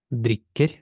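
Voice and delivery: "Дріккер" with a short, stressed "і"; short vowel. Shown as a demonstration. Teacher models a word for learners, short stressed "і" in "Дріккер". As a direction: cut the "і" short before kk in "Дріккер". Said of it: "Коротка".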